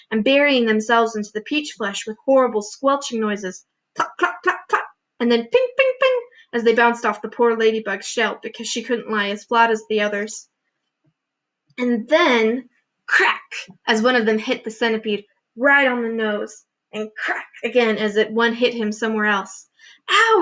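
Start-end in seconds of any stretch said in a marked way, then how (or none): 3.9-4.9 imitated squelch. A narrator's reading. Narrator mimicking hailstones hitting the peach, squelch sounds. 5.4-6.2 ping said very high and bright; ping like hail off a shell; imitated ping. A narrator's reading. Narrator mimicking hail pinging off the ladybug's shell, very high. 20.1-20.4 much higher loud yelp; cry of pain. A narrator's reading. Centipede hit on the nose by hail, yelping high. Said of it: "he cried".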